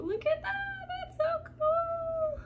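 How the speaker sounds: very high, stretched squeal of delight; awed squeal. Real, not acted. Painter admiring the pour, awed high squeal. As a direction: squeal it high, drawing out the last word.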